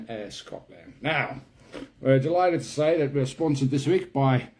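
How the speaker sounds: Kiwi comic character with an Aussie tinge; put-on New Zealand accent. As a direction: play a chatty mountaineer in an imitation Kiwi accent.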